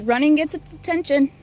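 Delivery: ordinary talk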